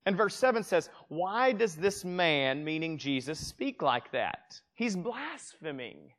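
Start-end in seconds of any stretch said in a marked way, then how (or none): 1.1-4.6 slow, deliberate reading; slow scripture reading. Acted. Pastor reading the scribes' words slowly and deliberately. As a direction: drag each word out for weight.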